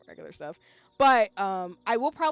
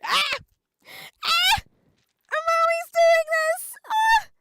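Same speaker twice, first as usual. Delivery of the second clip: very high, loud squeal; frantic outburst; 12 dB louder, much slower than usual. Real, not acted. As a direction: shriek it in exasperation at yourself. Frantic outburst at own habit.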